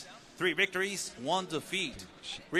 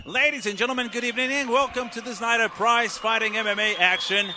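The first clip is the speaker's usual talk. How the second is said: loud, bright arena announcement; ring announcer; 3 semitones higher, 4 dB louder than usual. Acted. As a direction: project it to the crowd. Ring announcer introduces the event.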